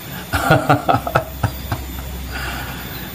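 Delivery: long, soft run of chuckles; amused laugh. Real, not acted. Commentator teasing Rizik, drawn-out amused laugh. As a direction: laugh on and on, quietly.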